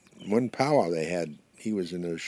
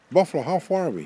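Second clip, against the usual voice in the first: high, loud storybook call; 10 semitones higher, 8 dB louder than usual. Acted. Swallowed porcupine asks buffalo how far, calling out. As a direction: call up a question from inside.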